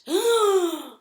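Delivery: sharp, high gasp of shock; the crowd gasps at the robot, loud and high. A narrator's reading. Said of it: "The crowd gasped".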